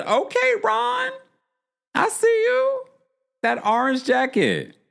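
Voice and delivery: very high squealed approval; admiring a flashy orange coat, squealed high; impressed. Retold or imitated. Fan admiring Ron's orange coat, very high squeal.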